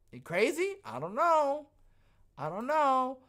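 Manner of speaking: high, teasing shrug of a voice; mock unsure. Streamer teases viewers about his crazy idea, coyly. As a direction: play coy about the wild theory.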